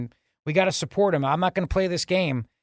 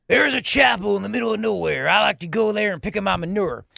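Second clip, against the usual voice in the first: mock Southern accent; 2 semitones higher than usual. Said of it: "he acts like he's from the South".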